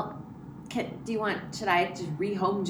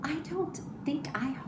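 hushed, breathy aside; whisper; 7 semitones higher, 8 dB quieter than usual. Real, not acted. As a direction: whisper it so nobody hears. Whispered aside about her son.

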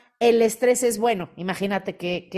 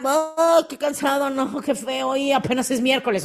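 mock weary whine; 4 semitones higher than usual. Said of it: "si yo me despertara".

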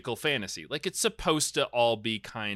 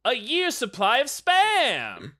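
high, loud, drawn-out prize call; hammed-up game-show host shouting out the prize; game-show announcer; 12 semitones higher, 6 dB louder, slower than usual.

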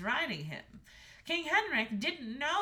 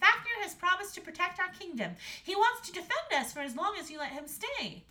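put-on high, raised girl's voice; earnest Astrid; 10 semitones higher, 6 dB louder than usual. A narrator's reading. Daughter pleading the dragon's case, high and earnest. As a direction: advocate earnestly, pitched high. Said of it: "said Astrid".

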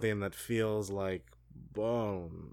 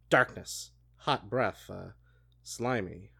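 ordinary delivery

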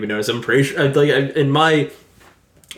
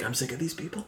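quiet quote; 15 dB quieter than usual. Retold or imitated. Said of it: "the line where he's like".

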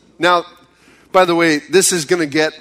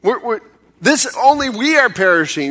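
accusing cry; 6 semitones higher than usual. Acted. Frightened disciples accusing Jesus in the storm, urgent. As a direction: cry out in alarm to the master. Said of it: "It's more of an accusation".

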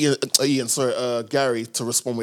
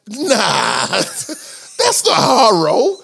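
much higher, louder put-on voice, laughing; 10 semitones higher, 4 dB louder than usual. Imitating [name] turning down the show, high and laughing. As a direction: play a cheeky guest refusing the gig. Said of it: "[name] was like".

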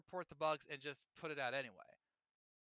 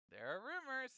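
teasing, more knowing; 9 semitones higher, 5 dB quieter than usual. Acted.

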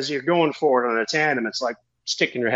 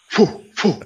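high mock whooshing of a spin; whirling phew sounds; spinning whoosh; 7 semitones higher than usual. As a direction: puff out the spin noise. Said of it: "I start spinning hard".

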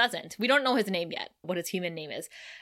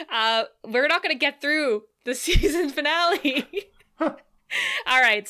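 louder, laughing through words; 6 semitones higher, 5 dB louder than usual. Real, not acted. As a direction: giggle while talking.

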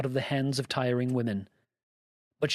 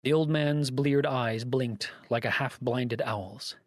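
usual speaking voice

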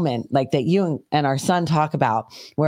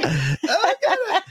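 high squealing laugh; wild laugh; 14 semitones higher than usual. Real, not acted. Woman cracks up at her gator story, high squealing laugh. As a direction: laugh until it nearly cries.

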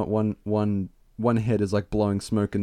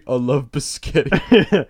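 slow, loud dopey voice; dopey brother; 3 semitones higher, 6 dB louder, slower than usual. Acted. Random head blurting with no context, dopey voice. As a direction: drag it out like a dim brother. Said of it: "like, the dopey brother".

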